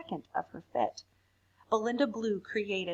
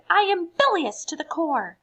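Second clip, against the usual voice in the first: louder, higher furious line; loud higher tantrum; angry outburst; 8 semitones higher, 8 dB louder than usual. Acted. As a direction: spit it out in a rage. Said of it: "she exploded".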